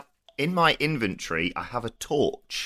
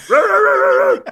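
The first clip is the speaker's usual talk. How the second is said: much higher, loud mock barking; dog bark impression; 11 dB louder than usual. Player voicing the shadow-puppet dog, loud mock barks. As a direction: yap like a little dog puppet. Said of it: "It goes".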